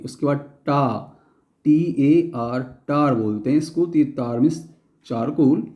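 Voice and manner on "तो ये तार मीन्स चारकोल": ordinary delivery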